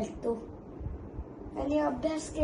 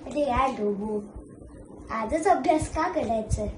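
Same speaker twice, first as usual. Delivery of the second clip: ordinary delivery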